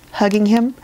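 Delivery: plain reading voice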